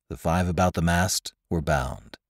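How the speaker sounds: ordinary delivery, nothing marked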